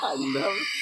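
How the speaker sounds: loud through laughter; laughing. Real, not acted. Laughing at own skit.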